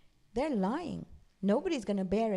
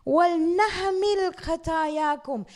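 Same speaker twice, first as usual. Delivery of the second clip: Quran recitation; 8 semitones higher, 7 dB louder, much faster than usual. Acted. Recites verse.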